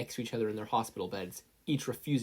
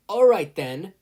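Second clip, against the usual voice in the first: very loud, high call; loud referee call; 8 semitones higher, 13 dB louder, slower than usual. A narrator's reading. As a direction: Midnight cracks her whip at the contestants, very loud. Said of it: "Midnight cracked her whip".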